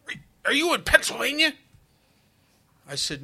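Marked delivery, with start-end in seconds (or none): 0.1-1.5 mother's high, loud, incredulous question; anne taklidi. Retold or imitated. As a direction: shriek it fast, as a disbelieving mother on the phone. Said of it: "And she says, she says".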